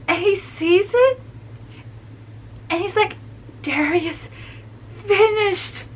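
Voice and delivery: high, halting, choked-up retelling; getting emotional. Real, not acted. Fan retelling Jack seeing the finished mansion, choked up. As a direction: pause heavily, voice climbing with emotion. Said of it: "I'm getting emotional right now".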